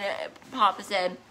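ordinary talk

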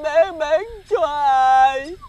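anguished drawn-out question. Acted. Desperate question about selling.

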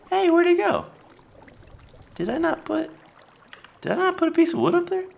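high, fast confused asking; suddenly lost and puzzled, voice jumps up; baffled. Real, not acted. Builder baffled by a vanished block, high puzzled asking.

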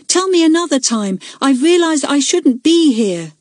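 high-pitched call over the shoulder; calling back. A narrator's reading. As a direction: call back while hurrying off, voice lifted. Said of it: "she called back".

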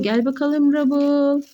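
normal talking voice

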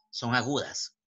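usual speaking voice

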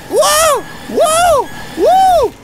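rising amazement; excited wows. Real, not acted. Watching Huggy win, high excited wows.

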